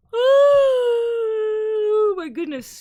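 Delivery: high, slow, drawn-out cry of shock; shocked gasp. Real, not acted. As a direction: gasp it out in disbelief. Shocked by the news.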